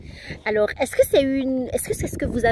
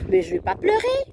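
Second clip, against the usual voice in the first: put-on little girl voice; 10 semitones higher than usual. Daughter voiced in retold chat.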